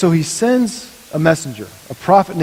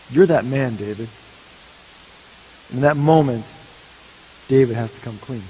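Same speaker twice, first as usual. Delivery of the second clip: ordinary delivery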